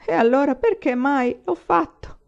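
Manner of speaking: high, loud tearful question; broken, sad voice. Acted. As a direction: ask it as if the voice is breaking. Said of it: "con voce rotta e triste".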